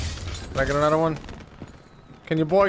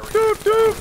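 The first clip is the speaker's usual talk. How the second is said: squeaky high toy-horn doots; high doot-doot jingle. Acted. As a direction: toot it like a silly horn. Jingle after the chant.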